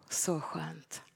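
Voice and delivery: breathy whisper of relief. Acted. Preacher whispers relief after escaping the wolf. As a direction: sigh it out in a whisper.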